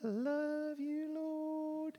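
mock a half-hearted little love song to God; limp, feeble praise; timid worship. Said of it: "the psalmist in worship going".

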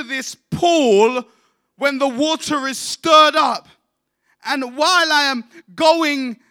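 high, loud, slow pleading preach; impassioned sermon delivery; preaching at full force. Acted. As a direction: plead the man's case from the pulpit. Preached voicing of the sick man.

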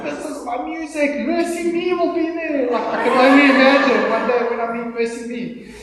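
high, loud, gushing retold excitement; excited fan. Preacher acting out his younger self thrilled about the concert, excited.

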